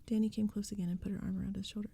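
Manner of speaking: usual speaking voice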